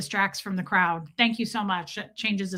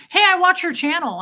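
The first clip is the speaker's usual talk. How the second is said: a higher, louder cheery greeting; friendly dad; 7 semitones higher, 7 dB louder than usual. Retold or imitated. A dad from the party greets her, higher and louder, cheerful. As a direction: play the eager fan dad.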